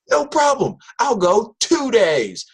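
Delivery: very high, loud, drawn-out mock teen voice; [name]'s cocky answer. Student [name] agreeing to the teacher's deal, high cocky voice. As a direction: toss it off like a kid who can't believe it's that easy. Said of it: "I'm like".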